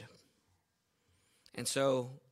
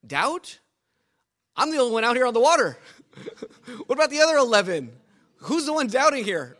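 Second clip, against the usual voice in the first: higher, louder and fast, mock-indignant Peter; indignant protest; 6 semitones higher, 7 dB louder than usual. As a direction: protest like it's obviously unfair.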